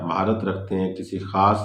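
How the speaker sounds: normal talking voice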